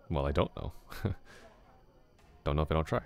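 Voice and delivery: usual speaking voice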